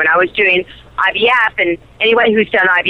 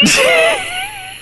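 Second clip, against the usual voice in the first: high shrieking laugh. Real, not acted. Laughing at own story.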